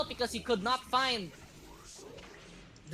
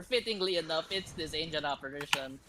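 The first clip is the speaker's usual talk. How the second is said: usual speaking voice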